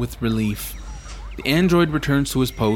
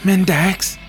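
urgent, higher-pitched hiss of a name; edgy whisper; 7 semitones higher, 4 dB louder than usual. A narrator's reading. Traveler calling on Mendax for help, tense and edgy. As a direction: call for help with an edge. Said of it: "I whisper, an edge to my voice".

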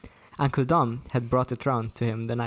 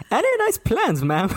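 Cockney cabbie's chatty question, pitched up; hammed-up Cockney cab driver; thick Cockney; 11 semitones higher, 5 dB louder than usual. A narrator's reading. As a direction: attempt a thick London Cockney, high and loud. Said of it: "a traditional London Cockney".

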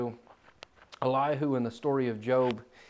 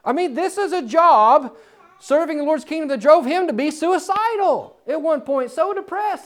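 high, loud, impassioned preaching; full-force preaching; 11 semitones higher, 9 dB louder than usual. Acted. Preacher driving home the prophet's burden to the congregation, loud and impassioned. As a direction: preach it out to the whole room.

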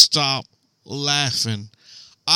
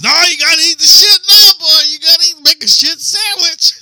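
much higher, loud mocking taunt; 8 dB louder than usual. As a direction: razz him in a high cartoonish voice. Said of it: "Paul goes".

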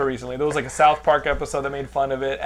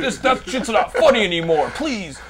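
louder, half-laughing protest; mock outrage; 6 semitones higher, 7 dB louder than usual. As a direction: scold the perverts, laughing. Mock outraged husband defending wife.

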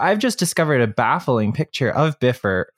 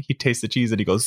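usual speaking voice

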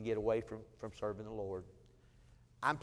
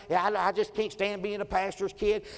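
higher, louder mock rant; 5 semitones higher, 5 dB louder than usual. Daughter ranting about being a pastor's kid, louder. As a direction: rave like a fed-up teenager. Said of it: "she was ranting and raving".

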